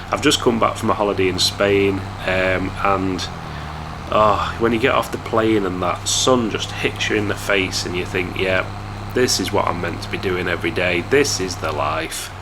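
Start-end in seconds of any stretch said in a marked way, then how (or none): none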